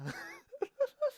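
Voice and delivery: high laugh after singing; high-pitched burst of laughter. Real, not acted. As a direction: crack up at your own singing.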